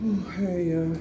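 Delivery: ordinary talk, nothing marked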